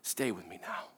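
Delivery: soft, low, tender plea; hushed tender reassurance. Retold or imitated. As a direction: say it gently, like holding someone close. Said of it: "it comes out very tenderly".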